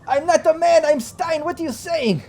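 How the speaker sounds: high-pitched panicky foreign prisoner; panicked captive. Acted. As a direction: squeal out the denial in a panic. GM voices Stein denying.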